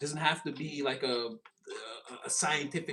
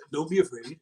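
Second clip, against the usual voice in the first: a hint of a glowing angel voice, louder; calm them like a heavenly being; angelic reassurance; 3 semitones higher, 3 dB louder than usual. Angel reassuring frightened people, lightly voiced. Angel calming startled onlookers.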